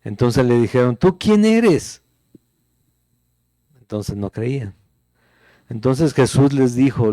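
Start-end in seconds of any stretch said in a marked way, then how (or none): 1.0-2.0 high, slow, scornful demand; high, drawn-out mocking challenge; challenging questioners. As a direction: mimic the doubters sneering at Jesus. The unbelievers challenge Jesus.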